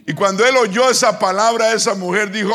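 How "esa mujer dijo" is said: usual speaking voice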